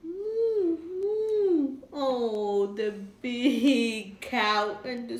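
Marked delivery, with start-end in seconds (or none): 0.0-1.8 higher moo for the little cow; light high calf moo; small cow moo. Acted. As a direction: make a tiny cow's moo.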